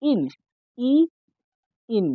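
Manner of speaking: ইন broken down to its vowel ই, then whole; sounding out ইন. Shown as a demonstration. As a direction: isolate the ending ইন, pausing around the ই. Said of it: "কি উচ্চারণ পাচ্ছ?".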